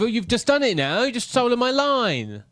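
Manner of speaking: high-pitched comic protest; mock indignation. Co-host whose Bond line was stolen, mock indignation. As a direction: accuse him in hammed-up outrage.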